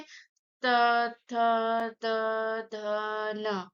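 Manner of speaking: त, थ, द, ध, न with tongue on the teeth; spaced recital of dental त to न; dental letters demonstrated. Shown as a demonstration. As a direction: sound out त, थ, द, ध, न one by one. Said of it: "आप बोलिए त वर्ग में".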